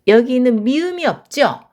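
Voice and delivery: normal talking voice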